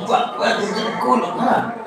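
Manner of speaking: slow, soft and laughing; laughing coax. Real, not acted. Mother laughing softly as she begs her child. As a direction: plead with a chuckle.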